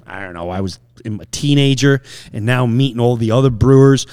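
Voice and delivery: ordinary delivery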